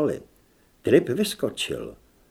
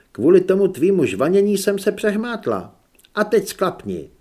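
higher, mildly cross scolding; irritated; 6 semitones higher, 4 dB louder than usual. A narrator's reading. Holly blames Zed's chatter for her slip, irritated. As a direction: tell off a chattering patient.

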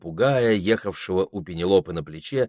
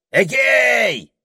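yelling; 8 dB louder than usual. A narrator's reading. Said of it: "заорал попугай".

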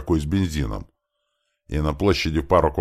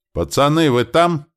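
high, loud call from afar; raised, high-pitched hail; 12 semitones higher, 7 dB louder than usual. Acted. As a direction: call out to the lads across a distance. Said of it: "услышали мы со стороны гномов громкий голос".

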